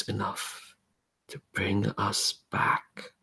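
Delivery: slow, soft, lightly voiced spirit; O'Gara's voice. Spirit O'Gara praising the devoted hero, softly. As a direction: coax the faithful one gently. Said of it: "You hear O'Gara's voice say".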